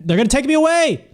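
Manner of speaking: high fast alarmed yell. Pretend. Breaks whisper to cry out.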